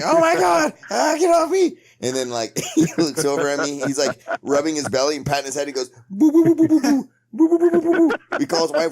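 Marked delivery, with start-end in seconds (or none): 0.0-1.8 high, loud panicked yelp; panicked cry. Retold or imitated. Frank being grabbed by the confused friend, high loud panicked yelp. 6.1-8.2 high loud repeated boo sounds; imitated hooting. Friend in diabetic episode trying to communicate, high loud boo hoots.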